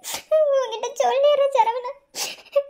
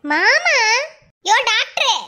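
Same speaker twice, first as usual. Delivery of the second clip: calling out; 6 dB louder, much slower than usual. Acted. Wife calling distracted husband.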